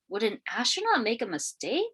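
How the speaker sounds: usual speaking voice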